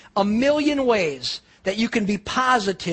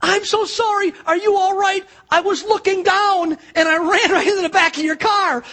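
put-on high, hurried girl's apology, much higher; teen girl blurting an apology to the man she hit; panicked teen girl; 9 semitones higher, 5 dB louder than usual. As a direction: play the flustered teenage driver apologizing. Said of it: "first thing she says".

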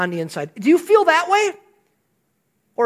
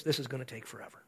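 muttered complaint; 9 semitones lower, 18 dB quieter, faster than usual. Retold or imitated. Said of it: "you're saying this".

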